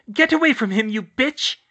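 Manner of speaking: high, loud furious order; outraged high-pitched yell; angry shout. A narrator's reading. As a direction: shout them off in fury. Hermione confronts the attacker.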